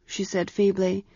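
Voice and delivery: usual speaking voice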